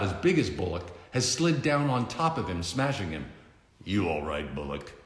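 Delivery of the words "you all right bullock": low, soft, dry hero voice; deadpan gravel tease; Batman's low taunt. Acted. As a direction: hero mocking a villain quietly.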